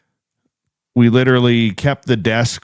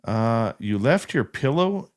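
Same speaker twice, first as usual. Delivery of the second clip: usual speaking voice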